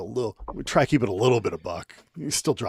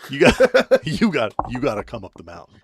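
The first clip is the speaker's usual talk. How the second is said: laughing mid-quote; 3 semitones higher, 7 dB louder than usual. Retold or imitated. Dad's answer, breaking into laughter.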